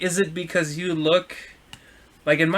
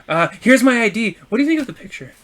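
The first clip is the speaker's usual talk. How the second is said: higher, louder flirty question; flirty tipsy customer; 6 semitones higher, 4 dB louder than usual. Retold or imitated. Customer shows the driver her ID, flirty and higher. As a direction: act the tipsy customer fishing for a compliment.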